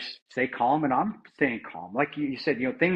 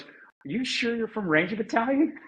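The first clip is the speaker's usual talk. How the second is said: mocking teammate; 9 semitones higher than usual. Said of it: "and he goes".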